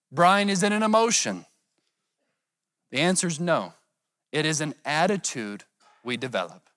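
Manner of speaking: ordinary talk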